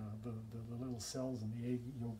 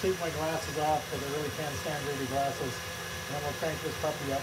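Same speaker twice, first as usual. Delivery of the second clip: raised voice over a running mixer; louder, carrying demonstration voice; talking louder over machine; 5 dB louder than usual. Real, not acted. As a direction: project louder to be heard over the motor. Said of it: "I'll try to talk a little bit louder".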